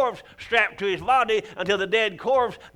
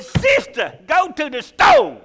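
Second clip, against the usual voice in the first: loud, high southern preacher telling her off; getting southern; 10 semitones higher, 9 dB louder than usual. Retold or imitated. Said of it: "I got a little southern".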